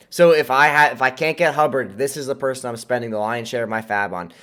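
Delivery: ordinary talk, nothing marked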